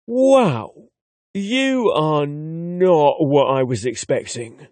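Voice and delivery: put-on a young Londoner's accent with a touch of wry humour, slowed on the second line; London accent. The son meets his father and is dryly surprised, London accent. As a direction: let the London accent carry some dry amusement. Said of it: "A hint of wry humor is evident in his London accent".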